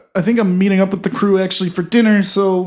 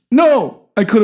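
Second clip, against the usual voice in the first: loud no; 5 semitones higher, 6 dB louder than usual. Acted. Sharp correction of old man.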